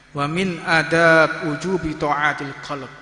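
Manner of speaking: ordinary talk, nothing marked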